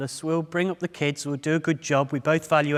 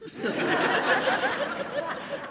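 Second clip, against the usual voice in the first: high airy giggle without voice; high breathy laugh. Real, not acted. Preacher cracking up at his own story, giggling. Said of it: "I'm thinking".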